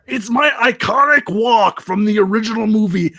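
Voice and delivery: high loud indignant shout; defensive shout. Acted. Bigfoot defending his walk to Anthony, loud shout. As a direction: defend your art.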